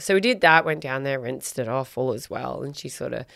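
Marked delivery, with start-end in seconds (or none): none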